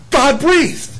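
God-breathed lifted high and stressed; emphatic God-breathed. Shown as a demonstration. Preacher stressing the word for the congregation, emphatic. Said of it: "I wish they would have left the word".

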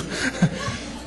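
breathy laugh. Real, not acted. Laughs at own joke.

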